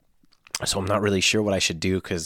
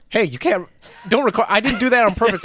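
high, raised frantic pleading; hammed-up panic, voice shooting up; acted panicked protest; 13 semitones higher, 6 dB louder than usual. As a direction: protest as if caught red-handed on camera. Caught on camera, pleading in role.

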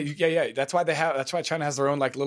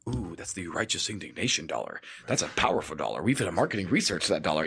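lower, quieter oily marketer voice; greedy marketing guy; 7 semitones lower, 4 dB quieter than usual. Retold or imitated. Comic imitating a marketer who prices outrage, lower and oily. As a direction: purr like a slick marketer smelling money. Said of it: "pretending to be, like, the marketing guy".

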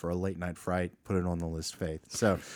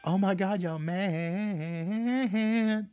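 much higher, lilting up-and-down greeting; Rebecca's sing-song; 10 semitones higher, much slower than usual. Retold or imitated. Host imitates podcast friend Rebecca's greeting, high sing-song. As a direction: mimic her singsong hello. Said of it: "she does that little sing song".

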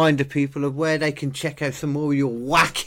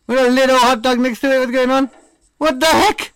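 very high, loud disbelief; shocked squeal; 12 semitones higher, 7 dB louder than usual. Real, not acted. Silly reaction to the video.

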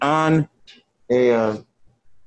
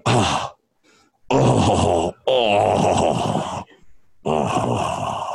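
mock groan; 8 semitones lower, much slower than usual. Drummer moaning through the PA.

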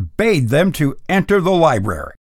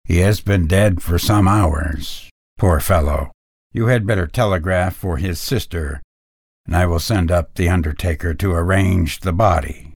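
lower, calm clinical tone; doctor voice; 8 semitones lower than usual. A narrator's reading. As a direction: diagnose coolly.

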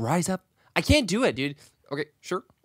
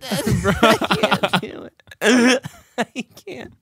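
ordinary talk, nothing marked

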